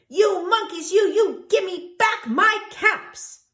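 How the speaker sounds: high, loud furious shout, slowing at the end; angry shout. A narrator's reading. Peddler shouting at the monkeys, furious and loud. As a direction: bellow at the monkeys in a rage. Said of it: "he shouted".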